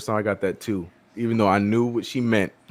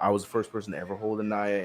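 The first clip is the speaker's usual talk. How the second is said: ordinary talk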